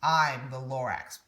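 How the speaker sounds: ordinary delivery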